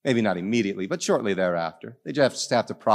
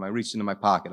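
normal talking voice

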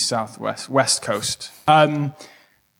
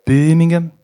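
put-on Birmingham in a light Brummie accent; a hint of Brummie on Birmingham; 3 semitones higher than usual. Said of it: "the only word I can say in Brummie".

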